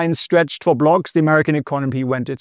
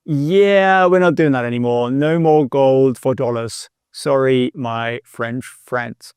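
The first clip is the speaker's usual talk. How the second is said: low, drawn-out mock presidential voice; President Nixon; 4 semitones lower, slower than usual. President telling France on television the gold deal is off, mock-presidential drawl. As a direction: drag it out low, falling away on the apology. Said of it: "President Nixon literally went on the television and he said".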